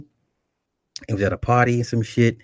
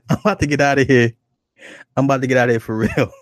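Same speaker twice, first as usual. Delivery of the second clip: loud, higher words through laughter; laughing; 4 semitones higher, 5 dB louder, faster than usual. Real, not acted. Comic about to leave after his own bit, laughing. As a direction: bail out while cracking up. Cracking up, threatens to quit.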